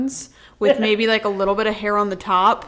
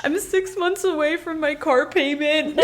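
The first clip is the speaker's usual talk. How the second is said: high-pitched wail breaking into laughter; joking, high, laughing lament; mock desperate plea; 8 semitones higher than usual. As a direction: plead in hammed-up despair, laughing. Mock wail about unpaid bills.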